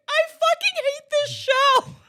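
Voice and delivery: very high, loud, slow howl; mock anguished scream. Friend ranting after Jedi Knight, furious wail. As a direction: imitate a fan screaming in despair.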